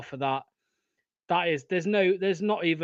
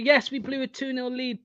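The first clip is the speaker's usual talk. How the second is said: normal talking voice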